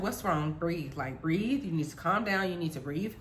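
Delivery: low, soft reassurance; calming mother. Retold or imitated. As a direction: soothe a crying child quietly. Voices mom calming crying child.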